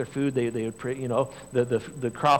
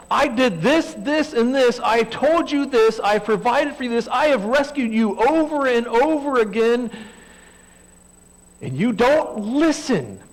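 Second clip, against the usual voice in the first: frustrated father; 11 semitones higher, 8 dB louder than usual. Retold or imitated. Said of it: "he's this frustrated father".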